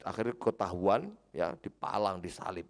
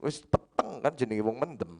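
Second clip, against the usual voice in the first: ordinary talk, nothing marked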